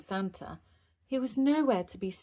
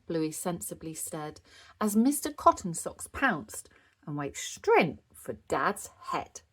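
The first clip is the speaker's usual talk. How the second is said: ordinary delivery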